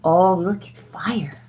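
soft whispered gasp and point; hush, look; excited whisper. Acted. Whispered discovery.

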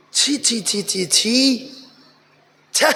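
slow, drawn-out tut of shame; disgusted tutting. Real, not acted. Preacher shaming a half-dressed son, disgusted. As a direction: shame him with repeated tuts.

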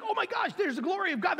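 high, fast, breathless wonder; excited. Retold or imitated. Stephen seeing heaven, high fast excited wonder. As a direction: blurt it out like you can't believe your eyes. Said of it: "he's like".

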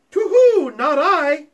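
high, loud owl hoot. Acted. Owl hoots its reply.

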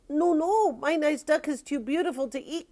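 put-on high-pitched, pleading little boy; boy crying out. A narrator's reading. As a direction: protest in a high child's cry. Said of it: "cried the boy".

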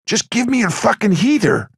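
high, exasperated demand; impatient demand. A narrator's reading.

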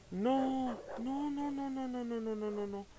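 rattled denial as the attack starts; rising panic; panicked no no. Real, not acted. Dogs attack in game.

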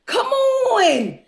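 normal talking voice

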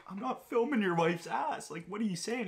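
high, disbelieving retold reply; mock incredulous denial. Answering the gym guy's accusation, high mock disbelief. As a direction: act baffled and offended.

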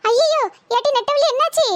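high-pitched, panicked wail of concern; alarmed cry. Acted. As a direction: cry out in shock at her collapse. Shock as possessed woman collapses. (பதறி அலறுவது)

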